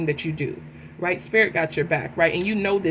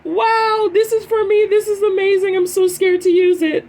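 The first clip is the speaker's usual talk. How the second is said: high, loud, gushing voice of someone dazzled, higher and louder; imitating an awestruck seeker; 12 semitones higher, 9 dB louder than usual. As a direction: gasp over the gift you are afraid to take. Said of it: "looking at the truth like".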